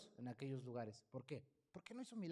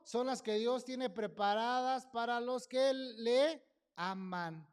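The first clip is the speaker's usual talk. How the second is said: slow, loud, ringing proclamation; preaching at full force; 6 semitones higher, 10 dB louder, much slower than usual. Acted. Preacher proclaiming God's promise to the congregation, slow and loud. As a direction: thunder the promise over the church.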